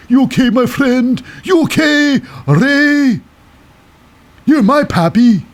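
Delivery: high, drawn-out mock concern; Santos Escobar. Santos checks on his friend Rey after the save, high mock-concerned voice. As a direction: put on a high-pitched pal's voice. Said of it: "then Santos Escobar rolls into the ring again".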